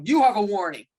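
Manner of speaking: loud, curt mock warning from a rigid ref; imitating a rigid official; stern warning. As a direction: shut the coach down flat. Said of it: "that man who won't let you talk, he'll just go".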